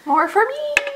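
high, teasing sing-song; playful teasing. Pretend. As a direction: tease her cheerfully. Teasing friend lactose intolerant.

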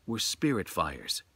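measured, hushed storyteller read; slow, grave storytelling voice; story narration. A narrator's reading. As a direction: tell it like a ghost tale by lamplight.

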